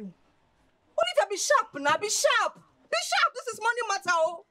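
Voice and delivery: high-pitched, loud wheedling; excited urging. Acted. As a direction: egg her on, voice leaping up high. Friend wheedles her over money.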